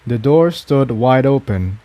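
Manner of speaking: ordinary delivery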